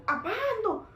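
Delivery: high, loud startled question; retold jump of surprise. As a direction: blurt it out in mock alarm. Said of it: "kaget dia gitu kan".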